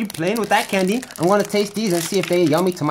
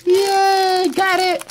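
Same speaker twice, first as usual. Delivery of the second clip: high, happy cheer; excited cheer; 12 semitones higher, 3 dB louder, much slower than usual. Real, not acted. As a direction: celebrate getting it open. Opening candy with scissors.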